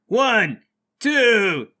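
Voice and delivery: loud, high count with gaps; shouted countdown. Acted. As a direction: call out the count to the team. Bart counts down aloud.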